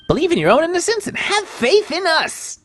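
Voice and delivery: high, impassioned urging; rally the client with pitch way up; rousing plea. Acted. Urging the client to have faith.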